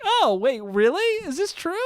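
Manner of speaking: high-pitched, smiling disbelief; amused surprise. Retold or imitated. Little cousin laughing off the news, amused surprise. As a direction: imitate a kid pleasantly taken aback. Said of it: "He just smiled and was like".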